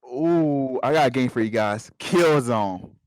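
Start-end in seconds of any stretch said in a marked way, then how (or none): none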